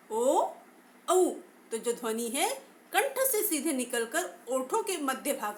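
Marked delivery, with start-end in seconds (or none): 0.1-1.3 ओ, औ sounded out louder; vowel demo. Shown as a demonstration. Teacher asks class to speak along again. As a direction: say ओ, औ once more, clearly. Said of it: "फिर से बोलकर देखिएगा".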